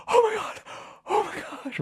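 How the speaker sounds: very high, breath-caught mock gasp; panting panic. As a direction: act out waking in panic, voice shot way up. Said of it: "I'm, like, panting".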